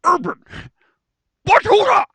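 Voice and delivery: booming high announcer "Urban Battuta"; announcer's voice. Pretend. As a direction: call the name like a hyped ring announcer. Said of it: "do it in the announcer's voice".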